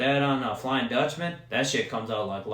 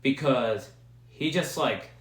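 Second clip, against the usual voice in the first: ordinary delivery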